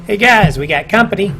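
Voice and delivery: loud, high call to comrades; goblin yell. Acted. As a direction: yell out a warning to the other goblins. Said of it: "these goblins yell back".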